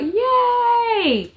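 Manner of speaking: a burst of happy relief; excited cheer. Retold or imitated. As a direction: shout it out with joy at the birth. Baby finally comes out.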